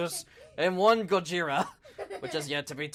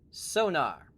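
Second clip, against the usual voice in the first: ordinary delivery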